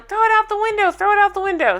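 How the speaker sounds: high excited yell. Retold or imitated. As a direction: shout it, egging them on. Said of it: "Kim starts yelling".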